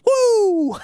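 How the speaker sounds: high, drawn-out mimicked whoop; imitated Vader woo. Co-host mimicking Vader leaning in, high whoop. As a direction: echo the wrestler's high "woo!". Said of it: "Vader leans in and goes".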